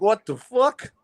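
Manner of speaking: high, mock stunned woman; mock shock. Imitating the caseworker's shock, high. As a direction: play her jaw dropping. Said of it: "She was looking like".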